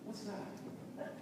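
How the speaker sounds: soft, high, near-whispered question; hushed curiosity. Acted. Preacher re-enacts smelling things in a store, hushed curiosity. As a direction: act out a hushed, curious query.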